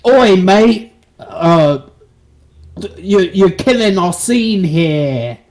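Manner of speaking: loud, high British geezer; Christopher Nolan, hammed-up British. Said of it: "He's just British".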